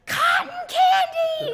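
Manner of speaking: high, loud drawn-out yell; child's yell. Retold or imitated. Imitating a child yelling for cotton candy across the market, high drawn-out yell. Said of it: "the second a child yells".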